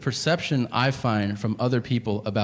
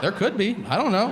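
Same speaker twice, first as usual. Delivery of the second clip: defensive husband; 7 semitones higher than usual. Retold or imitated. Husband defensively answers alarmed wife.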